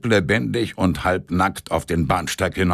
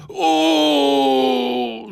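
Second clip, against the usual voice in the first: moan; 6 semitones higher than usual. Acted. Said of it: "stöhnte Semjonitsch".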